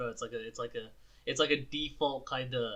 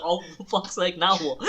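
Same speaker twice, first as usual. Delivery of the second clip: hammed-up irritated groan of a line, louder; mock annoyance; 4 semitones higher, 6 dB louder than usual. Friend pretending a call interrupts him, mock annoyed. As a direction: fake exasperation at a ringing phone.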